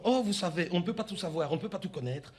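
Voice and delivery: put-on soft, low agent with a faint African accent; hint at an African French accent, unruffled; lightly accented, softly placating African agent; slight southern accent. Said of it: "je détecte tout à coup comme une légère pointe d'accent".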